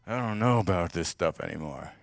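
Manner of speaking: low and soft, worn out and done; weary burned-out voice. Retold or imitated. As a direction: sigh it out like you've given up. Voicing a burned-out churchgoer.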